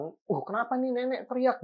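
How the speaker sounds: high, alarmed asking; startled question. Retold or imitated. Sister startled by grandma's scream, high alarmed question. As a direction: jump in pitch as if startled mid-prayer. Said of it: "otomatis kaget kan".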